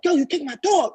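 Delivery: lightly acted indignant protest; mock outrage. Dog owner confronting the man who kicked it, imitated outrage.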